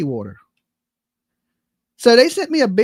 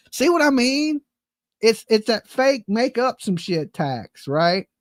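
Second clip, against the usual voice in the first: ordinary delivery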